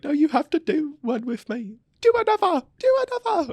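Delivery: imitating friend. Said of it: "she was like".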